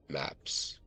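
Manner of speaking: usual speaking voice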